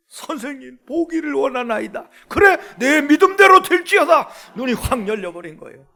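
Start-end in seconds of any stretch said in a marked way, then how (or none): 0.1-2.1 raised, earnest acted voice; imploring beggar's answer; blind man's plea. 2.3-4.3 high, booming shout; acted authoritative cry of Jesus; commanding proclamation.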